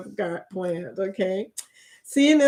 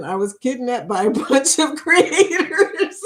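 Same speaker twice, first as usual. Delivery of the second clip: breaking into laughter; 4 semitones higher than usual. Real, not acted. Joking to viewers about an excuse for the captain, breaking into laughter. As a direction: crack up on the last words.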